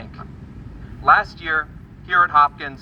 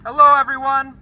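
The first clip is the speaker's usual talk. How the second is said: rally speech; 10 semitones higher, 5 dB louder than usual. Real, not acted. Greeting rally crowd.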